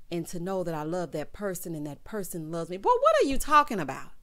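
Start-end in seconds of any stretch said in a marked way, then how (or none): 2.8-4.1 louder, higher "what are you talking about"; baffled outburst; exasperated. Real, not acted. Exasperated at his riddles.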